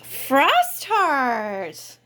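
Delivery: ordinary talk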